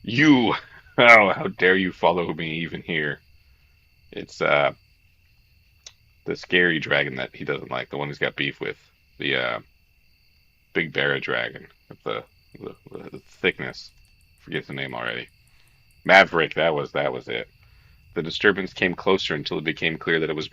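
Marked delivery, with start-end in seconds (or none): 0.0-3.2 shouted accusation, surprised; calling out. Acted. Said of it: "before he called out". 16.1-16.6 excited. Real, not acted. Suddenly remembering the name.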